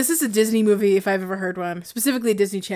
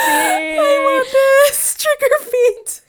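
excited squeal; 4 dB louder, much slower than usual. Real, not acted. Excited cheering over pun.

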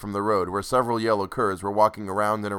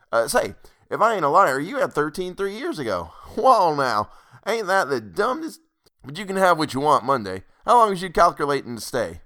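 pushy landlord demanding rent; 6 semitones higher, 4 dB louder than usual. Acted. Landlord greets guest, calls Ed.

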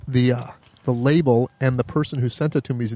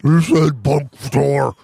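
talking bear voice; much slower than usual. Retold or imitated. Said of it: "the bear can talk, but can't talk well".

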